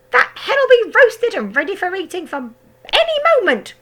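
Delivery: high, loud crone voice; shouting grandma. A narrator's reading. Grandma shouting from the roof at George. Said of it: "Grandma shouted".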